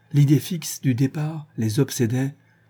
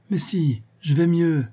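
insisting; 6 semitones higher, slower than usual. A narrator's reading. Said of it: "déclara la Bretonne".

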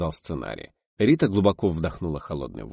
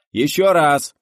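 high, loud order; barked command; 8 semitones higher, 9 dB louder, slower than usual. A narrator's reading. Director orders another take, commanding shout. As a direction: order a retake at full voice. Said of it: "скомандовал Гайдай".

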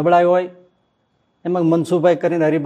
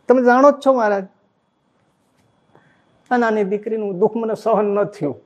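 pleading father; 6 semitones higher than usual. Retold or imitated.

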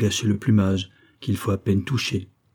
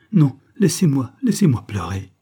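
louder, higher cry to be left alone; tearful plea; 5 semitones higher, 5 dB louder than usual. A narrator's reading. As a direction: beg to be left to weep. Madeleine fuit en larmes. (supplique en pleurs, plus forte et plus aiguë)

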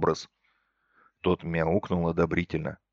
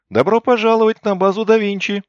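high, loud, grand announcement; торжественное провозглашение; 13 semitones higher, 9 dB louder than usual. A narrator's reading. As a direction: proclaim it like a ceremony host. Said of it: "торжественно провозгласил". (торжественно, громко провозглашая)

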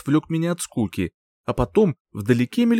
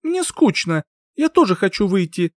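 whiny; 8 semitones higher than usual. Acted. Heroine whines.

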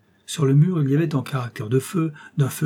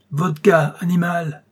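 barked order, higher and louder; shouted order; 5 semitones higher, 4 dB louder, slower than usual. Acted. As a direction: yell for vodka at the servant. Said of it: "lui cria le général".

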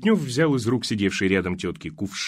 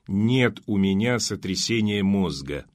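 ordinary delivery, nothing marked

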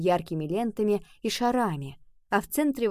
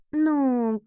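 drawn-out, higher hesitant "well"; a reluctant, stretched murmur; mumbling; 5 semitones higher than usual. A narrator's reading. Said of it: "пробормотала Нова".

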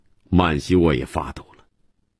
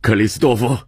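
calling out; 5 semitones higher, 3 dB louder, faster than usual. Acted. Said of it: "曼西沃却叫了声".

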